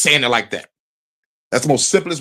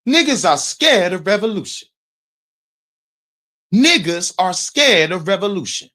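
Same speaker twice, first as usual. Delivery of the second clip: declaimed slogan; 7 semitones higher than usual. Acted. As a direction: declaim it well above your usual pitch. Repeats the line as a declaimed slogan.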